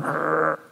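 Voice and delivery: short mock growl of frustration; inner angry growl. Believer's hidden anger, a short mock growl. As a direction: growl it under the breath. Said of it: "But inside you're going".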